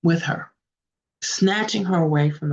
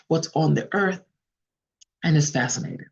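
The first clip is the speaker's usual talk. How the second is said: ordinary delivery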